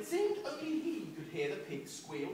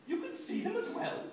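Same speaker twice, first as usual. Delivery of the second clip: higher, astonished question; shocked; 10 semitones higher than usual. Acted. Auntie Em answers Ralph, shocked. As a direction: sound genuinely shocked. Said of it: "said a shocked Auntie Em".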